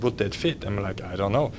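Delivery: ordinary talk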